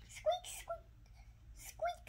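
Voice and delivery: tiny, very high squeaking voice; baby bird squeak. Acted. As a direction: squeak like a chick inside its shell. Egg cracking more.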